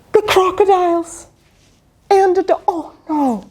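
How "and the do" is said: ordinary delivery, nothing marked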